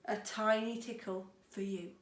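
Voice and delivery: ordinary delivery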